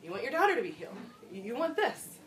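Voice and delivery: ordinary talk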